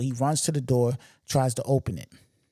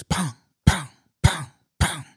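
loud, punchy pong sounds for shots; mock gunshots; 3 semitones higher, 5 dB louder than usual. Robber shooting at the locked door, loud mock gunshots. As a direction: fire the pongs off like gunfire.

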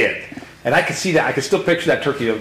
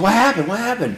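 retold alarmed cry, pitched much higher; alarmed call; 10 semitones higher than usual. A son running up to his wounded father, alarmed. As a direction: call out in sudden panic.

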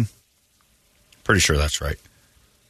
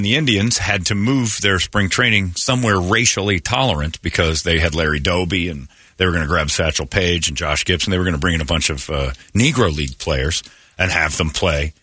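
normal talking voice

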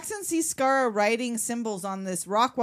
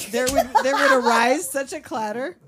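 louder, higher sing-song verse recital; poem recital; 4 semitones higher, 9 dB louder than usual. Pretend. Riffing on the joke, mock poem recital. Reciting Night Before Christmas.

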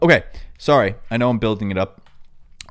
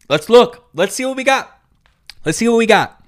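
high, loud and eager; excited; 13 semitones higher, 8 dB louder than usual. Real, not acted. About to read viewers' roasts, excited and loud. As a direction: hype it up before reading.

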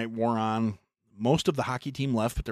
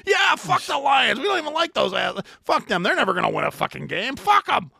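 mock shout; 5 dB louder than usual. The friends shouting back at the locals, high and loud. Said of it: "We're like".

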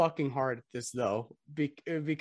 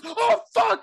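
high, loud startled yell; kid's panicked outburst at the beeping bag; startled shout; 10 dB louder than usual. Retold or imitated. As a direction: yell it as if a bomb just landed at your feet. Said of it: "this kid goes".

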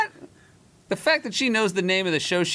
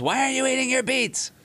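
retold yell, higher pitched; wife yelling; 9 semitones higher than usual. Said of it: "and then she yells".

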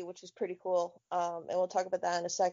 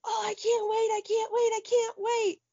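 put-on high, bouncing child's chant; eager daughter; 11 semitones higher than usual. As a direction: squeal it like an impatient kid. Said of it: "She goes".

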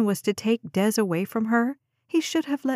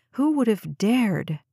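ordinary delivery

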